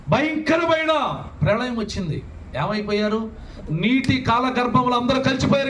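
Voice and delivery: preaching with rising fervour; fervent preaching. Acted. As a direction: build the sermon to a passionate peak. Preacher rises to fervent exhortation.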